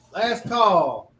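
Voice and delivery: barkeep repeating call. Acted. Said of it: "barkeep's like".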